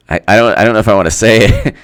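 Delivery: ordinary talk, nothing marked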